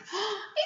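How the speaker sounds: sharp, soft intake of breath; gasp of wonder. Pretend. Teacher opening the builder's toolkit for children, wondering gasp. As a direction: gasp as if amazed.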